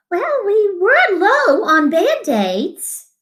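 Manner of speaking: usual speaking voice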